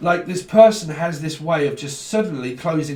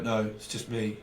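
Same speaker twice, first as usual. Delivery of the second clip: withdrawn mumble; 5 semitones lower, 9 dB quieter than usual. Retold or imitated. Voicing withdrawn person.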